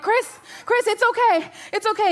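high, loud, frantic retold reassurance; panicked reassurance. Mother telling her son it's okay, acted panic. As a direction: insist it's fine while clearly panicking.

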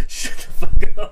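breathless, laughing protest. Real, not acted. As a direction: wheeze it out laughing. Friend laughing at nickname.

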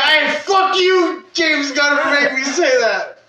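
fake-furious yell, hammed up; mock outrage. Joking, cursing the director for making him love Cena, mock shout.

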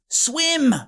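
high-pitched one-word urging call; calling across. A narrator's reading. Wilfred urges Boo across the pool, calling out. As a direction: send it over the water. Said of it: "he called across the pool".

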